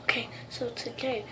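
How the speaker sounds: hushed whisper. Real, not acted. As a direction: whisper so the brothers can't hear. Sets up secret prank.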